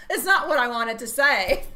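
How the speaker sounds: words pushed out through real laughter; laughing. Real, not acted. Host to viewers after her fries joke, laughing through words. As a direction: try to talk but keep cracking up.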